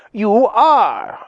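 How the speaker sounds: high, insistent accusation; indignant fox squabbling; raging retort. A narrator's reading. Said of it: "working herself into a great rage".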